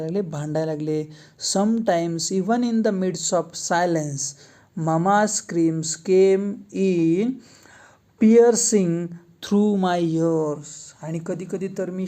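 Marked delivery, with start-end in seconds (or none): 1.6-10.6 reads the English line word by word, very slowly; slow read-aloud. Shown as a demonstration. Teacher reading the lesson text aloud to students, slow and deliberate. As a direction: dictation pace for students.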